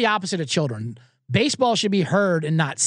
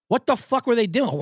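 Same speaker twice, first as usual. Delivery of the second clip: ordinary delivery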